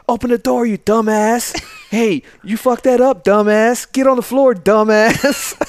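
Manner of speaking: high, loud mock yell of a drill sergeant. Sergeant Anderson yelling at him through the door, high loud mock yell. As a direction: bellow it like a sergeant blowing up.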